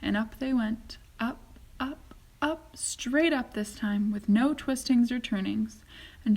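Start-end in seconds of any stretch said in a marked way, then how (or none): none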